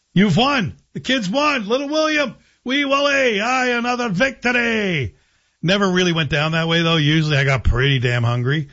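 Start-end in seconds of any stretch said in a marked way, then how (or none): 0.1-5.1 triumphant Scottish cheer, much higher; Scottish accent. Acted. Little boy wins the standoff with parents, triumphant Scottish accent. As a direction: crow it in a high Scottish accent. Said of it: "in a Scottish accent".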